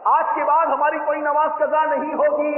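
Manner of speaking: high and loud, chanted out; forceful shouted vow. Shown as a demonstration. Preacher leading the crowd in a loud vow. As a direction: shout the pledge with full force. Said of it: "जोरदार इंशाअल्लाह का नाम लगा".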